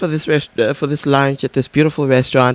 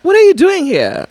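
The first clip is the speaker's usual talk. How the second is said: high, louder surprised voice; mock surprise; 5 dB louder than usual. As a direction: squeal the question in surprise. Voicing surprised ex meeting.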